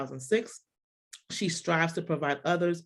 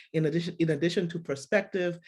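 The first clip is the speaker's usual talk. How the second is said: ordinary talk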